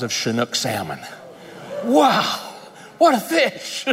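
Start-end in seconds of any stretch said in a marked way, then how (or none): 1.8-3.9 much higher, laughing in delight; laughing wonder. Real, not acted. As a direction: marvel at the catch through a laugh. Relives catching the huge fish.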